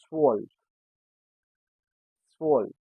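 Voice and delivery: swollen said as German zwölf; German pronunciation. Shown as a demonstration. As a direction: model swollen as one syllable, "tsvölf". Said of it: "sounds as S".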